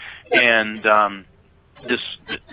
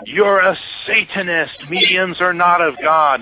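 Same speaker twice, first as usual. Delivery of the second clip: high, slow imitation of a denouncing heckler; mock accusation; 7 semitones higher, slower than usual. Woman with Bible denouncing a medium, mock accusation. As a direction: pronounce judgement, mock-indignant and drawn out. Acted heckler at support group.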